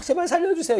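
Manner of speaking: high, begging cry for mercy; mock desperate begging; mock pleading.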